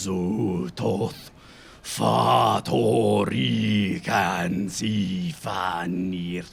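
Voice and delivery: low, slow, hushed incantation; a mad scientist chanting a summoning; sinister ritual voice. Acted.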